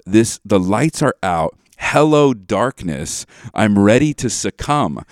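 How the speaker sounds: repeated line, just as heavy and loud; hammed-up tragic reading with weight on "I"; mock-solemn re-read; dramatic lyric recitation. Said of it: "it's so intense".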